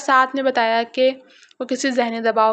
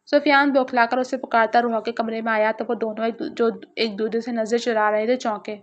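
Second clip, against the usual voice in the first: usual speaking voice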